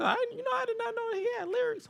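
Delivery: high, soft mocking voice; mock-dopey whiny high voice; mocking a clueless fan. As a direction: make them sound clueless. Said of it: "I was dying laughing when someone said".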